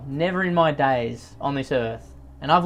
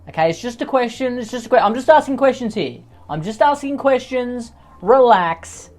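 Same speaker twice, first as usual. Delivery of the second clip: high, raised-voice mock defensiveness; defensive protest; 8 semitones higher, 6 dB louder than usual. Host fends off objectors to his question, defensive. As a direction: protest your innocence, louder up to the last word.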